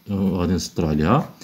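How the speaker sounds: ordinary talk, nothing marked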